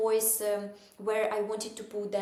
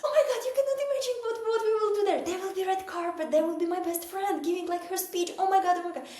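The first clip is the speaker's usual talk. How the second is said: excited; 11 semitones higher, 4 dB louder, faster than usual. Retold or imitated. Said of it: "I was like".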